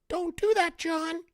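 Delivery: squeaky high little gnome; tiny gnome voice. Pretend. Imagined gnome scolding Fetterman, squeaky high voice. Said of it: "a little garden gnome".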